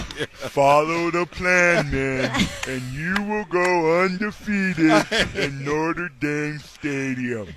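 put-on slow, high lisping old coach; Lou Holtz impression. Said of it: "Well done on the Lou Holtz".

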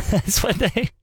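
can't hold back a laugh. Real, not acted. Host laughing while reading the official excuse.

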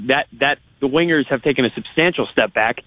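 ordinary delivery

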